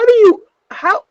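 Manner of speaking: high squeaky words through laughter; laughing. Real, not acted. Co-host trying to speak mid-laugh, laughing. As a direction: try to talk while cracking up.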